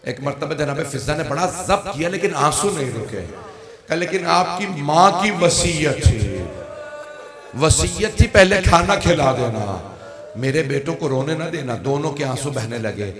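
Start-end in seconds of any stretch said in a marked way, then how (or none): 3.9-11.8 weeping cry. Acted. Fizza telling the young princes their mother's will, weeping loud cry. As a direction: wail the mother's last wish.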